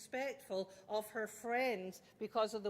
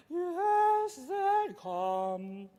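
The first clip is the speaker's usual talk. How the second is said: loud, hammed-up zen chant; mock zen calm; 5 semitones higher, 8 dB louder, much slower than usual. Shown as a demonstration. Intense parent forcing herself calm, hammed-up zen. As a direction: force the calm out loudly, half-joking. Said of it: "I know I have to go".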